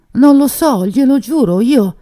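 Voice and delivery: high, loud, frantic denial; desperate pleading. A narrator's reading. Terrified young suspect swearing innocence to the commissioner, desperate and loud. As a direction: beg them to believe you.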